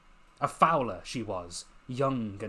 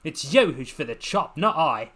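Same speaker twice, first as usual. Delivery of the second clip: retort; 3 semitones higher, 7 dB louder than usual. Acted. Lucy retorting while hurling bones.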